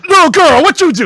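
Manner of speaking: high, loud mocking inmate voice; mocking taunt. Retelling an inmate jeering at a scared kid, loud mocking taunt. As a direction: jeer at him like a tough inmate.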